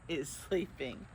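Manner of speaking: usual speaking voice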